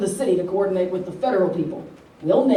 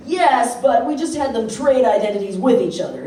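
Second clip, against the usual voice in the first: normal talking voice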